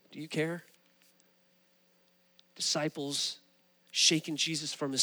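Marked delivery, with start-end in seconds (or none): 0.1-0.6 quiet plea. Acted. Believer's prayer during a trial.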